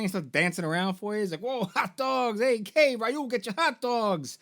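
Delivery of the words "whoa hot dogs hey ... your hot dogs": high, drawn-out hot dog vendor caricature; Tom. Acted. Hot dog vendor calls Ryu over in a high, slow caricature. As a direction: sing out the sales pitch in a high put-on voice. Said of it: "So you talk to Tom at the hot dog stand".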